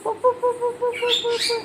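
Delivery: high, soft sing-song run run; playful urging. Real, not acted. Mother cheering her toddler to run over, high soft sing-song. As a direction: cheer the little one on, high and light.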